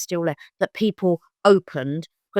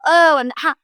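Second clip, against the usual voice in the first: high, loud, flamboyant exclamation; imitating a flamboyant, excitable mother; 9 semitones higher, 5 dB louder than usual. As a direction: gush it theatrically, pitched up. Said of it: "so flamboyant".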